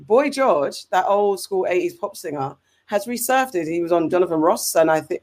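ordinary talk

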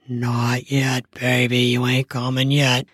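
low, teasing male voice; dominant man. Acted. Man in dom mode holding his partner back, low. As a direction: hold her back, low and in control.

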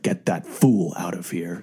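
slow, hissing villain voice; sinister lisp. Retold or imitated. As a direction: drag out the S, low and menacing. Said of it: "he's like".

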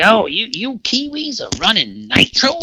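high, loud cartoon cowboy voice; Yosemite Sam. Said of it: "He looks like Yosemite Sam".

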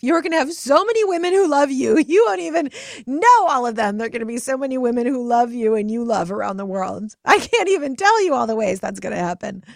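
excited promise. Pretend. Mother excitedly promising her inner child a life full of friends. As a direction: swell into a joyful promise.

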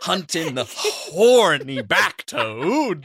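can't stop stressing "back". Retold or imitated. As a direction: drag it out and lean on "back". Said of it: "I can't not emphasize back".

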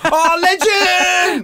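very high, slow, drawn-out shout of legend; mock shout. Host hamming up the cleaner's greeting, drawn-out shout. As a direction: stretch the word out in a big mock cheer.

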